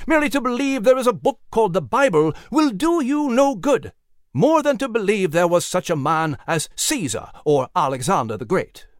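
put-on fervent old-time sermon voice; preaching. A narrator's reading. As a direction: preach it to a packed field.

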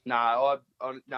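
ordinary talk

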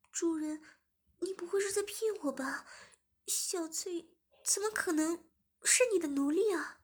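high, hurt questioning; hurt plea. Acted. Zhimin asks the master if she is only a slave, high hurt voice.

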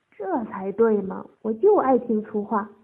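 loud, pleased approval; amused gloating. A narrator's reading. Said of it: "陈三笑道".

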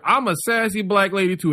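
onstage announcement. Retold or imitated. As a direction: deliver it as a comic's entrance line. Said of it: "and we just hear".

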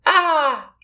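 put-on very high baby squeal; one-year-old. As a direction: echo it like a tiny toddler. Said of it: "the one-year-old is in her high chair".

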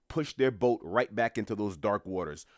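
ordinary delivery

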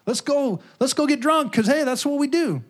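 high, eager party invitation. Retold or imitated. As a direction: sell the drinking like a fun idea. Party family voice, egging on.

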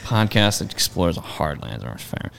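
dejected. Retold or imitated. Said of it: "it was a real dejected".

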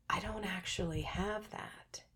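very soft, slow, voiceless confession; hushed admission. Real, not acted. Admitting her revelation isn't really hers, hushed. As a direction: drop to a slow near-whisper. Painful realization confided to audience.

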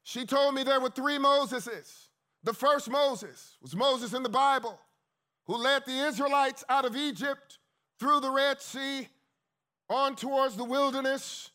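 high, loud sermon cadence; full-force preaching. Acted. Preacher telling the congregation about the first Moses, preaching loudly. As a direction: preach it out with rising fervour.

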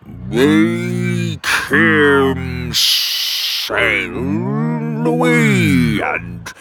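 very slow, rumbling giant voice. Acted. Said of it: "rumbled one of the cave giants".

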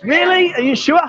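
high, playful "really?"; mock amazement at a free deal; teasing disbelief. Real, not acted. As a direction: pretend to doubt the offer, grinning.

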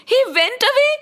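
high, rising incredulous question; stunned questioning; shocked disbelief. Acted. Krishna echoes it in disbelief.

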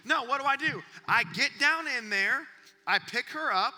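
loud, rising sermon build; full-voiced preacher storytelling; preaching. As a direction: preach it with fire.

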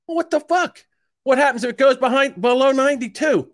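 very high-pitched, incredulous retort; mock alarm. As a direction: squeal it in exaggerated panic at the advice. Retelling his alarmed question to doctor.

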